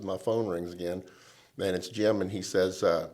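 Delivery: usual speaking voice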